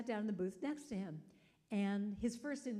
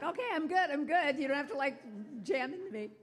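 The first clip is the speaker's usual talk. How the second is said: higher, louder, playful brush-off; mock protest; 5 semitones higher, 5 dB louder than usual. Pursued toll payer to grateful driver, mock protest. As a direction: wave them off in comic alarm.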